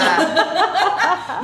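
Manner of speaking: loud, high, drawn-out gush breaking into laughter; gushing exclamation. Real, not acted. Friends hyping each other up, gushing loudly.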